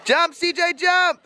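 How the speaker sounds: high cheer urging the jump; cheering them on. Real, not acted. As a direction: shout them into the pool.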